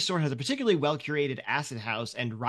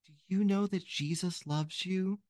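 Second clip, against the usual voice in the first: put-on higher, sweet little-girl voice; a little girl preaching to strangers, higher and eager; ten-year-old girl; 3 semitones higher than usual. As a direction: play an earnest child handing out Bibles. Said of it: "ten-year-old girl walks up to you".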